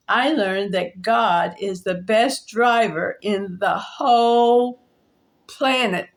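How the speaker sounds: slow, stressed the whole; testimony with weight on whole; emphatic lesson. Acted. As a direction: lean hard on whole. Closing lesson of her story.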